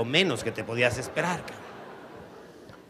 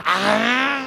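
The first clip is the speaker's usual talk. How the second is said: high, loud startled cry; mock startled yell from the band; musicians' startled shout; 10 semitones higher, 8 dB louder than usual. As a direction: yelp like the band got a shock. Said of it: "los músicos ... hacían".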